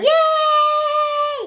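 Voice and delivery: high, loud squeal of joy; excited cheer. Pretend. Pet cheering about decorating together, high excited squeal. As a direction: cheer it like a thrilled little pet.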